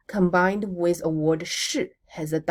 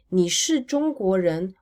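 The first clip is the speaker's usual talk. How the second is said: ordinary talk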